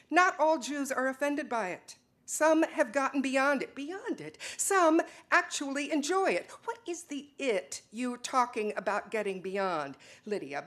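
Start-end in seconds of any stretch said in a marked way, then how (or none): 0.1-6.5 heated arguing. Acted. Argument about German heats up.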